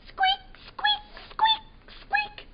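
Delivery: tiny high squeaks; mouse squeaks. Acted. Imitating the scampering mice for a child.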